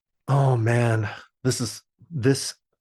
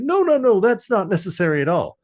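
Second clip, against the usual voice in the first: higher, quick polite brush-off; mock polite refusal; 8 semitones higher, 4 dB louder than usual. Granddad fakes politeness to get rid of the grandmother. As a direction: wave her away too politely. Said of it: "And it's like".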